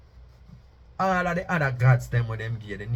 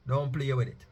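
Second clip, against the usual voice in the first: usual speaking voice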